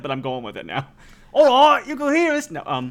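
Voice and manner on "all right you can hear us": a cat in a hammed-up Cockney accent, much higher and louder; game master voicing a studio cat, bad Cockney accent. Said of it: "this cat has a bad Cockney accent".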